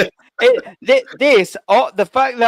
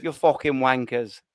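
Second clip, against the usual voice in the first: mock Scouse accent; 7 semitones lower, 4 dB quieter than usual. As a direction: put on a hammed-up Liverpool accent. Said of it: "There's the Scouse accent".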